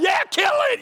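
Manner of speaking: high, loud shout joining in; cheering shout. Retold or imitated. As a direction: yell along with the boy, all in. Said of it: "I'm like".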